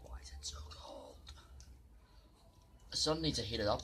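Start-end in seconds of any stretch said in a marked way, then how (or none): 0.0-1.1 breathy whispered complaint; whispering. Real, not acted. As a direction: whisper it to yourself. Whispered complaint in cold water.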